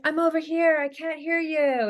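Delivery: playful call from the end of the aisle; calling out from afar. Pretend. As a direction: call out like she's far down the aisle. Calling across store aisle.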